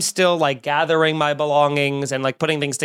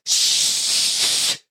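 drawn-out unvoiced shush; a long hissing shush at the talkers. Old woman shushing the talkers behind her, long hiss. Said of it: "the longest, most obnoxious shush".

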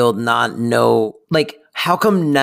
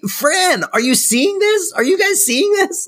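much higher, climbing panicked amazement; freaking out; 12 semitones higher than usual. Pretend. Host acting out Brighton's panic at the lookalike. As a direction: shriek it like reality just cracked. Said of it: "acting out freaked-out Brighton".

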